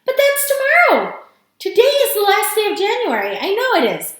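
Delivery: high, loud outburst of realisation; alarmed cry. A narrator's reading. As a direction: exclaim it in urgent dismay. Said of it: "cried Missus Bucket".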